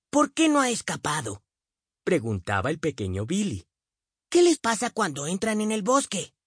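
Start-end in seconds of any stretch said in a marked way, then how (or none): 0.1-1.4 put-on young boy voice. A narrator's reading. Billy's question, boy voice. 4.3-6.3 put-on young boy voice. A narrator's reading. Billy asks, boy voice.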